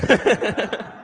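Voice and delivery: short real laugh; amused laugh. Real, not acted. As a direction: chuckle at your own joke. Laughs after own joke.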